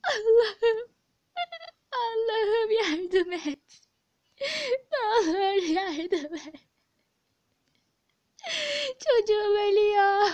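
slow, drawn-out sobbing prayer; crying. Acted. Mother begging God to save her child, sobbing. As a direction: wail the words through tears. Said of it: "Hanımefendi ağlamayın".

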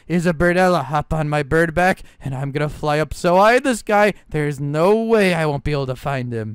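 ordinary delivery

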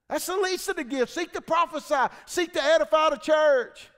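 loud, high, driving preacher's voice; fiery preaching. Acted. Preacher firing up the congregation, loud and driving. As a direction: preach it out full force to the church. Preaching at full force again.

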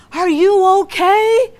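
a passerby's loud, concerned question; concerned stranger. Retold or imitated. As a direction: call through the window to a sobbing driver. Stranger knocks on car window.

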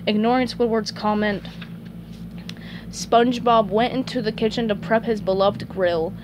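plain reading voice

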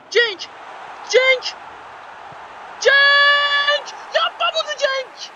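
rising high, loud cry of a name, then slow despair; anguished building shout. Real, not acted. Gamer screaming at his striker for the miss. Striker misses big chance.

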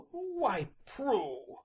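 high first word falling away on the name; choked. A narrator's reading. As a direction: start to plead, then choke up. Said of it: "but choked suddenly".

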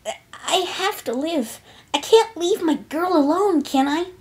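high, slow-starting tender promise; Rainbow Dash. A narrator's reading. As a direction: reassure a sweetheart with a weak smile. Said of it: "Rainbow Dash smiled weakly".

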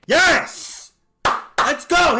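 loud, high cheer after a hit; excited yell. Real, not acted. Big play in baseball game.

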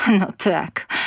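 usual speaking voice

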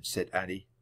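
ordinary delivery, nothing marked

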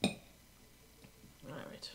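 low unvoiced whisper; defeated whisper. Real, not acted. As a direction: breathe it out beaten. Defeated sigh.

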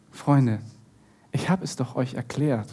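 low, soft acted explanation; patient reproach. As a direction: gently remind friends what you told them. Jesus patiently reproaches the disciples.

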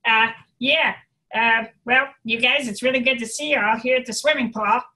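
loud, placed back in the adenoids; adenoidal voice. Shown as a demonstration. Teacher greeting people at the pool in demo, adenoidal voice. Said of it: "go back into the adenoids".

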